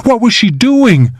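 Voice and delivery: ordinary delivery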